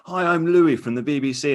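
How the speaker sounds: mock the awkward cheery hello; Louis Theroux greeting. Host imitates Louis entering a polygamist community. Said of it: "he'll go in and say".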